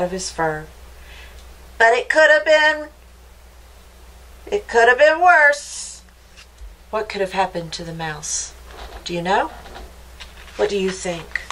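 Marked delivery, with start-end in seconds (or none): 1.8-6.0 raised, loud storyteller refrain; emphatic refrain. A narrator's reading. Storyteller cues the refrain after the thistle, loud and emphatic. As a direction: punch it out higher and louder, rising to the key word.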